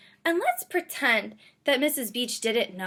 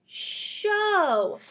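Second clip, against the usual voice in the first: aha discovery; 9 semitones higher, 5 dB louder than usual. Shown as a demonstration. Realizes the word.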